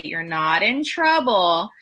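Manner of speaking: slow and firm, not in trouble; reassuring emphasis. Shown as a demonstration. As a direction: stress that they're not in trouble. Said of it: "I always say".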